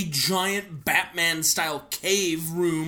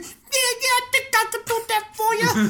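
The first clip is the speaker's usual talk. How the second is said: high, eager cartoon cloud voice; a cheery high-pitched sky spirit; Mister Cloud voice. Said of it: "That's what Mister Cloud sounds like".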